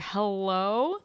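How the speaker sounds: long hello sliding up high; mock come-on with a rising swoop; all-caps hello. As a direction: imitate his admiring greeting, stretched out. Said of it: "anytime I looked really high".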